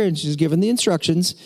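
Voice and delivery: ordinary talk, nothing marked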